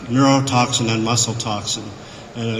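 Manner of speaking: usual speaking voice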